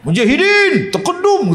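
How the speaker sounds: high, laughing mock jinn reply; mocking spirit voice. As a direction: sneer back like a jinn. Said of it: "kata dia".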